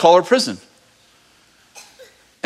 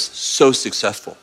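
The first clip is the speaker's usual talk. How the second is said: lower, softer, slow reassurance; gentle God voice; 9 semitones lower, 4 dB quieter than usual. Pretend. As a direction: soothe them, slow and low. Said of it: "God goes".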